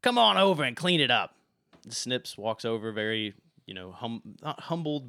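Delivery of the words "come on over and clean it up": high and loud, barking orders across the shop; bossy call. Acted. Valette loudly orders Snips to clean up. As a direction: call him over like a servant. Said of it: "she says".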